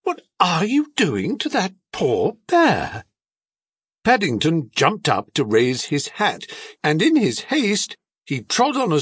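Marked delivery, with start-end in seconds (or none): 0.0-3.1 high-pitched indignant question; shocked reproach. A narrator's reading. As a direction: scold a husband on the bear's behalf. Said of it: "cried Missus Brown".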